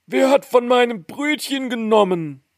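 high-pitched character voice; third dwarf. A narrator's reading. Third dwarf asks who took his bread, high dwarf voice. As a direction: question in a high little dwarf voice. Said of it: "Der dritte".

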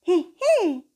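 very high tiny giggle; high squeaky giggle. Acted. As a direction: squeak out a little laugh. Ball character giggles.

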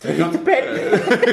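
high, slow mocking childish tone. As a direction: repeat it like a whiny kid. Mocking repeat of quote.

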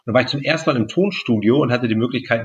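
ordinary talk

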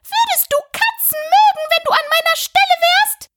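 very high screeching mouse voice; screeching, furious. A narrator's reading. Offended mouse to Alice, screeching and furious. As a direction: squeal it in outraged fury. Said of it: "mit kreischender, wütender Stimme".

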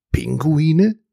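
mildly astonished question, rising at the end. A narrator's reading. Dragon questioning the narrator about penguin teachers, astonished. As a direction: echo it back in mild surprise. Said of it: "fragte Turmalin erstaunt".

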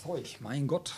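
muttered from across the room; faint voice in the next room; from another room. Real, not acted. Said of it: "Jetzt ist er an der Maschine".

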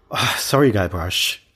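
ordinary talk

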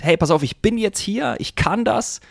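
deutlich höher, sich selbst Mut zusprechen; aufmunternde Selbstansprache. Pretend. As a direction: sprich dir selbst Mut zu. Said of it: "aktiv sagst". (hoch und aufmunternd zu sich selbst)

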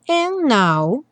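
and now said as "an now", no D; dropped D. Shown as a demonstration. Teacher shows dropping the D. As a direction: drop the D of and, run into now. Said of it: "tirar o D".

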